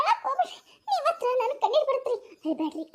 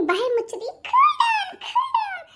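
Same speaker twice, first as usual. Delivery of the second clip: mock shout; 10 semitones higher, 4 dB louder, much slower than usual. Mock scolding friend.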